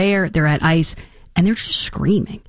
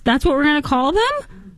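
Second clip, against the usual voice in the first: higher, scornful rhetorical questions; mock outrage; 8 semitones higher than usual. Host scoffing at calling rioters activists, higher scornful outrage. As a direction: sneer at the label. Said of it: "I was like".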